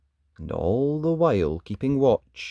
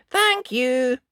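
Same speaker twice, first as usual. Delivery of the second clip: put-on little girl voice; 12 semitones higher, 4 dB louder than usual. Dot thanks the witch.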